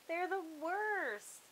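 high slow squeaky wail. Acted. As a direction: squeal it in dismay. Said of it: "Mona squeaked".

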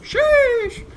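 high, hyped-up character squeal; Loba voice. Retold or imitated. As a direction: squeal it like an amazed teammate. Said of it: "You know that Loba's like".

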